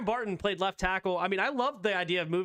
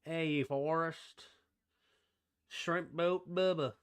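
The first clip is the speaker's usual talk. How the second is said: slow, soft, lightly voiced Southern Bubba; a hint of Forrest Gump, drawn out slowly; mild Southern drawl riffing on Forrest Gump; 4 dB quieter, slower than usual. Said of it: "Bubba Gump obviously comes from Forrest Gump".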